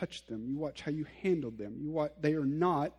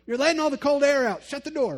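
loud, high mock parent yell; shouting at the kids to shut the door; 10 semitones higher, 9 dB louder than usual. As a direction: yell it like a fed-up parent about the cold air. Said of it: "did you guys get yelled at as kids".

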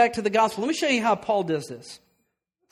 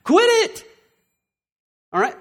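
mock yell, the second part jumping higher; father calls out to his baby in the crib, mock yell; yelled command; 3 semitones higher, slower than usual. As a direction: snap at the toddler with a quick high yell. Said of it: "So I yelled out to her".

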